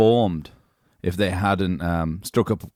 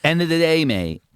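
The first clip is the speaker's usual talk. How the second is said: put-on swaggering Manchester accent, higher; [name]; 5 semitones higher than usual. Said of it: "Can you keep doing Liam".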